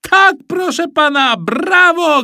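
loud, very high game-show bravo; excited shout. A narrator's reading. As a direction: hail the winner as a radio host. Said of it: "krzyknął pan z radia". (entuzjastyczny krzyk prowadzącego)

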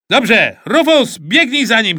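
loud, high urging shout. A narrator's reading. Supervisor ordering Rufus to chase Alcest, shouting. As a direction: send him running with a yell. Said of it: "krzyknął Rosół".

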